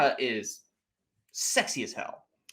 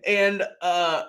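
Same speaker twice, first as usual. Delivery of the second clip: ordinary talk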